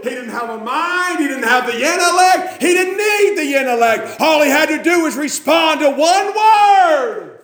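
high, impassioned pulpit shout; full-force preaching. Real, not acted. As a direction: drive it home at the top of your voice.

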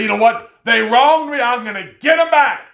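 loud, high indignant shout; vengeful outburst. Retold or imitated. Preacher acts out wronged people vowing revenge, angry shout. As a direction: shout it as someone set on payback.

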